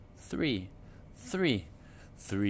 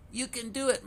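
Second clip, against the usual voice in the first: encouraging mother duck; 12 semitones higher, faster than usual. A narrator's reading. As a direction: cheer the little ones on. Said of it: "Mama said".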